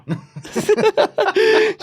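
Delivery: a high, real burst of laughter. Real, not acted. Laughing while telling a friend about the catio.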